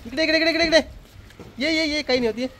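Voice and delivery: high, rapidly repeated beckoning. Real, not acted. Father coaxing child into the rain, high-pitched calling.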